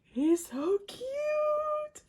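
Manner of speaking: a very high, slow squeal. Real, not acted. Fan sees her favourite's card, slow high squeal. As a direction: squeal it out in delight.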